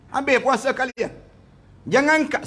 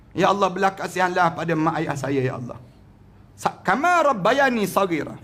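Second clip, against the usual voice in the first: lower, humble pleading prayer; humble prayer for parents; 4 semitones lower than usual. Acted. Models a prayer again.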